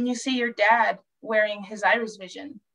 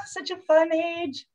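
sarcastic; 7 semitones higher, slower than usual. Acted. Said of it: "a little sarcasm in the voice".

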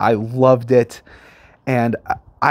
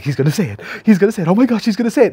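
an excited fan getting higher with each repeat; mounting excitement; 7 semitones higher, much faster than usual. Retold or imitated. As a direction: build to high, giddy anticipation. Acting Deadpool's mounting anticipation.

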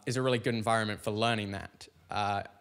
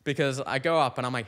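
ordinary talk, nothing marked

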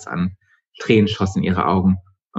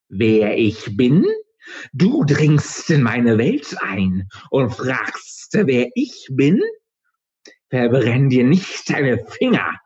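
slow, higher, mocking creature hiss; hissing monster; 4 semitones higher, much slower than usual. A narrator's reading. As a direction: stretch each word like a threat. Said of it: "zischte es erneut aus dem Wasser".

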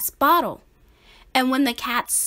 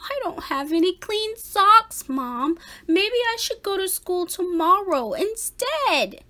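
put-on higher kid voice, pleading; Splat's excuse; 9 semitones higher than usual. A narrator's reading. Splat pleading with mom to skip school. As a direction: beg to stay home. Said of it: "said Splat".

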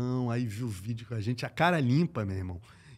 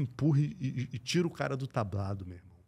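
ordinary talk

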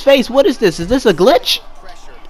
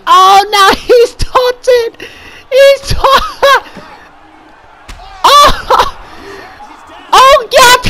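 much higher and louder, shouting at the screen; excited yelling; 7 semitones higher, 4 dB louder than usual. Real, not acted. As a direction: plead for the bell between laughs. Shouting at fighter during knockdowns.

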